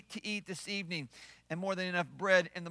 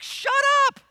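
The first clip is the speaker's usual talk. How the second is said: high, loud "fed-up Moses" yell; hammed-up exasperated outburst; exasperated mock shout; 11 dB louder than usual. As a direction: explode as a worn-out leader. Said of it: "I bet Moses was tired at this point".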